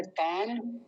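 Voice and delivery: ordinary delivery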